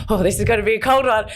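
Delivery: high, fast, bracing yourself; bracing for the cold. Retold or imitated. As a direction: gear up for a freezing shower.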